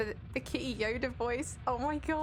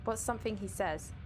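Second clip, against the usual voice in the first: usual speaking voice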